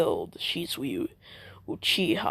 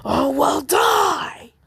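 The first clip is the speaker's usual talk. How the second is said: high, loud rising battle scream; berserk shout; 9 semitones higher, 8 dB louder, much slower than usual. Acted. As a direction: explode into a scream as he charges. Jugo charges screaming.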